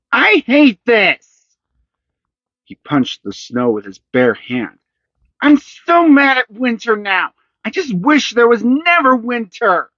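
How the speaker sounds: put-on a boy raging at the snow; furious boy. A narrator's reading. Furious boy after falling.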